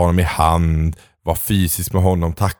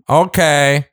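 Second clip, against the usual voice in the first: mock eager okay; 7 semitones higher than usual. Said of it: "Du hade varit så här".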